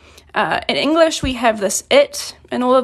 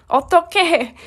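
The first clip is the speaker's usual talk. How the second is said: rising, higher cry of "어떻게?" as if lost; dismayed exclamation; 5 semitones higher, much faster than usual. Shown as a demonstration. Teacher demonstrating a lost, dismayed cry. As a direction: blurt "어떻게?" like you don't know what to do. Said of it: "an exclamation you can say when you're kind of lost".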